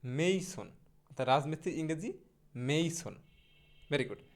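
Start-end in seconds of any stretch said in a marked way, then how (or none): 0.0-0.7 মেইসন with a long gliding "ei" in the first syllable; corrected pronunciation. Shown as a demonstration. Teacher modelling the English for mason, মেইসন with an "ay" glide. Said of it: "আমার সাথে সাথে বলেন". 2.7-3.1 মেইসন with a long gliding "ei" in the first syllable; corrected pronunciation. Shown as a demonstration. Teacher modelling the English for mason, মেইসন with an "ay" glide. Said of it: "আমার সাথে সাথে বলেন".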